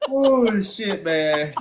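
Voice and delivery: high cracking laughter; laughing hard. Real, not acted. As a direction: laugh through the words. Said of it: "laughing hard".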